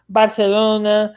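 "Barcelona" slow, with a lisped th for the c; "Barthelona", drawn out with a Castilian lisp; Spanish lisp. Shown as a demonstration. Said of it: "Parece que a pessoa tá tendo".

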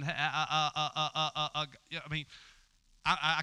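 mock stutter on repeated syllables. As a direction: get stuck on "uh" like a stammerer. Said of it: "I stutter".

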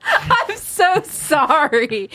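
high, drawn-out words through giggles; laughing apology. Real, not acted. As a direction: apologise helplessly while cracking up. Cracking up at own dirty joke.

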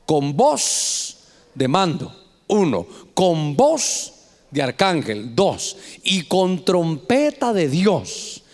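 each phrase drawn out, low and weighty; solemn, deliberate scripture read; slow solemn emphasis. Acted. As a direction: lay down every word slowly like a proclamation. Preacher reads the trumpet verse.

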